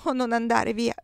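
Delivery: usual speaking voice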